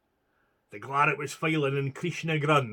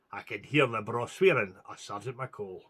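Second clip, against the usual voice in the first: ordinary delivery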